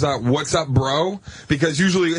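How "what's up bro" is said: slow, drawn-out what's up bro; lazy dude greeting; mock bro, stretched out. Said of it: "the guy that goes".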